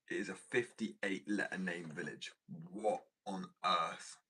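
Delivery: slow, emphatic disbelief; drawn-out, baffled exclamation; real incredulity. Real, not acted. Incredulous at the village name's length.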